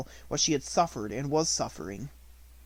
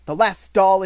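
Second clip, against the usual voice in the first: much louder sneer; scornful; 6 semitones higher, 14 dB louder than usual. A narrator's reading. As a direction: throw it back at her. Minchin mocks.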